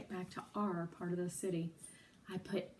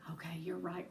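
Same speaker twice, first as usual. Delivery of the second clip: whispered; 3 semitones lower, 7 dB quieter than usual. Acted. Giving in, whispered reply.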